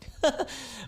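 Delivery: short, high giggle; light real chuckle; amused giggle. Real, not acted. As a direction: laugh briefly at the cat. Amused at the cat jumping down.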